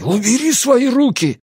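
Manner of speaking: high, sudden outcry; desperate shout. A narrator's reading. Ansel breaking free of Lucy's grip, desperate shout. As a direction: cry out in despair, without true malice. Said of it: "выкрикивать ничего подобного".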